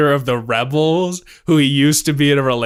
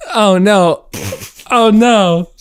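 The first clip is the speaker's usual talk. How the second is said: high, loud, hammed-up alarm; building fake panic, pitch climbing; mock panic; 8 semitones higher, 5 dB louder, slower than usual. Hammed-up climax of improvised erotica.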